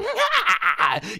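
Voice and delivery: high-pitched evil laugh; villainous cackle. Retold or imitated. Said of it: "he killed it as Baby".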